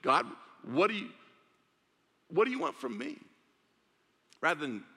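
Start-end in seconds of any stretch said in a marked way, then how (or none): 0.0-3.2 louder, higher, indignant asking; mock demanding believer; demanding question. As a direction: push the question at God. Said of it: "if you flipped it".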